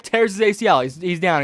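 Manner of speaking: normal talking voice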